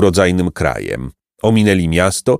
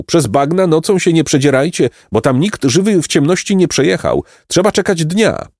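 high, animated farmer's warning; warning peasant; 10 semitones higher than usual. A narrator's reading. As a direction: caution the travellers as a country man, pitched up.

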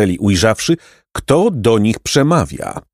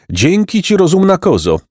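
cheerful; 9 semitones higher than usual. Acted. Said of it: "rzekł Jacek wesoło".

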